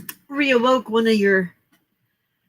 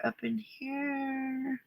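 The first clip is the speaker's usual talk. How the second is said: slow, stretched, softer sing-song repeat; drawn-out echo; 5 semitones higher, 12 dB quieter, much slower than usual. Pretend. Host teasingly echoing her question to chat, drawn out. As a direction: stretch the words out lazily like a playful echo.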